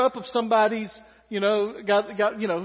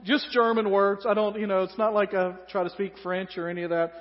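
ordinary talk

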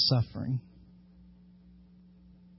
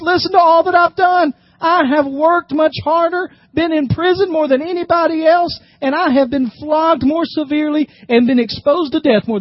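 high, rising, proud questions; emphatic scripture reading; 8 semitones higher, 4 dB louder than usual. Acted. Preacher reading Paul's boast to the church, high and emphatic. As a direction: read it as Paul's fierce boast.

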